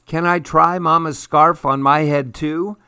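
a hint of a toy bunny's secret voice. A narrator's reading. Bunny asks to try Mama's scarf, light secret voice. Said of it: "Bunny asked in her secret voice".

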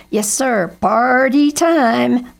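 high, loud, drawn-out cheer; party whoop. A narrator's reading. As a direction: whoop it up as a crow leading guests. Crow cheers on the guests.

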